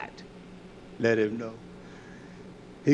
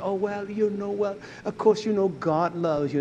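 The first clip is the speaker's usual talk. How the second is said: lightly voiced mild preacher, higher; a hint of a timid preacher, pitched up; mock gentle preacher talk; 7 semitones higher than usual. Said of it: "you talk to this generation".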